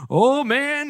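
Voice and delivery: eager Esau. Retold or imitated. Esau arriving with his platter, excited. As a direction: play up an eager entrance.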